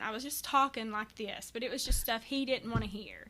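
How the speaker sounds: ordinary talk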